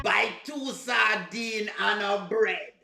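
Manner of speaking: mimicking a laboured Jamaican accent, words dragged out slowly; slow, overworked mock Jamaican; bad Jamaican accent. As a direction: drag out every word in fake Jamaican. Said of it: "work we have to do for sound like that".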